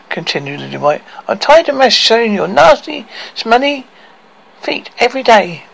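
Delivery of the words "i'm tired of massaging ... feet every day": high, fed-up complaining. A narrator's reading. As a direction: complain bitterly.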